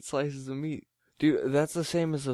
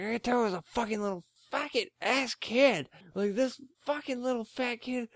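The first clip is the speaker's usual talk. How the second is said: high-pitched panicked voice; high squeaky freaked-out kid; 11 semitones higher than usual. Retold or imitated. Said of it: "He's like".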